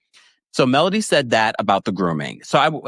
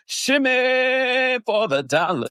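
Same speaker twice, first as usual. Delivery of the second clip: playful high sing-song; 13 semitones higher, much slower than usual. Pretend. Playful chant to viewer.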